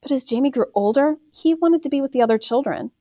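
usual speaking voice

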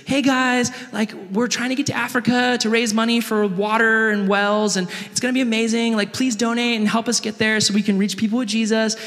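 high-pitched, eager missionary appeal; missionary's pitch. Retold or imitated. Missionary asking a church for money, high imitated pitch. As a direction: put on a high voice pleading for donations. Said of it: "a missionary comes up on stage and says".

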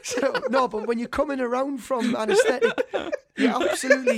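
talking through big laughs; words breaking into laughter; laughing. Real, not acted.

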